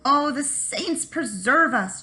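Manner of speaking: slow, high, drawn-out whine; whining. Acted. Lazy Jamie whines to his wife to avoid digging, whining. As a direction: moan like a lazy man avoiding work. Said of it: "Jamie would whine".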